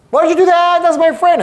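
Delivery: high, loud indignant squeal; upset trainee. Retold or imitated. Trainee protesting to the coach who hung up, high loud whine. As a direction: protest like a wronged rookie.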